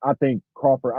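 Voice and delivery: ordinary talk, nothing marked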